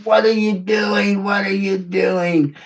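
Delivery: retold furious yell at a drunk son; furious shout. Father yells at his drunk son stumbling home, furious. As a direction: shout it out angry at two in the morning. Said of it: "I was so furious".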